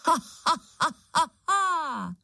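ghastly witch laugh. A narrator's reading. Witch's laugh echoing through ruins, ghastly. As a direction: cackle high with a long falling last note. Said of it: "Příšerně rozlehlo se zříceninami".

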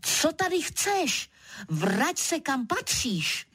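high-pitched scolding, no real volume; mild mock shout. A narrator's reading. Narrator shouting at a leopard in the garden, mild shout. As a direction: order the beast back where it belongs. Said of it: "a křičím".

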